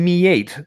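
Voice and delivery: "mate" split into "me eight"; odd two-part "mate". Retold or imitated. Father quoting his son's greeting, "mate" stretched into "me eight". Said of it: "he likes saying".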